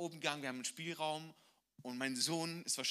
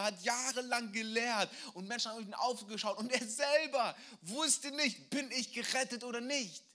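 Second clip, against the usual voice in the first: high, loud impassioned sermon; full force preaching; 8 semitones higher, 5 dB louder than usual. Acted. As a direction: preach it with urgency.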